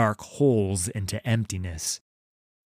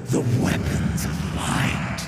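cold hissing layered whisper. Acted. Thresh's voice.

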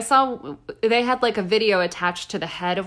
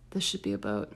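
dopey low voice; 12 semitones lower, 11 dB quieter, faster than usual. Pretend. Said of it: "was like".

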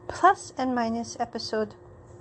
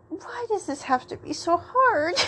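high, hammed-up lament; mock exasperation; 7 semitones higher than usual. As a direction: wail it playfully, about to laugh. Acted exasperation at drunk friend, then laughs.

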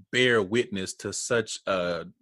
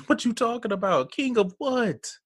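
incredulous mockery; 12 semitones higher than usual. Real, not acted. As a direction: scoff at the king talk. Mocking the men's boasts.

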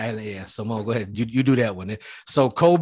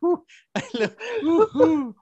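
mock moaning; 3 dB louder than usual. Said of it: "She's patting herself like".

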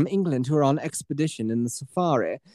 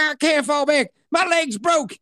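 higher, strained wounded soldier; 11 semitones higher, slower than usual. Retold or imitated. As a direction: refuse to retreat, higher and strained. Wounded gunner answering.

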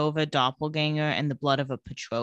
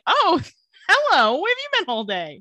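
mock surprised greeting; 11 semitones higher, 5 dB louder than usual. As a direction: act out an exaggerated surprised greeting. Voicing Elena greeting Stefan at home.

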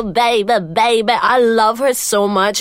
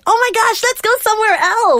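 much higher, squealing; high excited squeal; 12 semitones higher than usual. Retold or imitated. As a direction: squeal it like a tipsy friend. Tipsy friend urging to leave.

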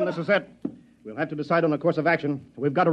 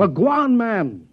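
higher, louder impatient push; impatient prodding; 7 semitones higher, 3 dB louder, slower than usual. Acted. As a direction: hurry him up, exasperated. Impatient chief presses aide.

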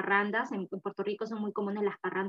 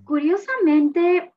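amused; 8 semitones higher, 10 dB louder, much slower than usual. Real, not acted. Amused drawn-out opener.

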